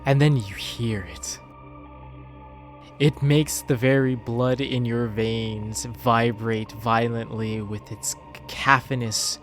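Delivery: normal talking voice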